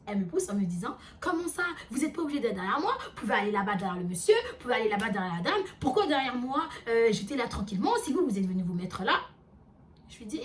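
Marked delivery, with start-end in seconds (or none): 1.2-9.2 louder, insistent protest; retold indignant outburst. Shoving passenger orders the narrator to move, indignant. Angry passenger pushes and argues.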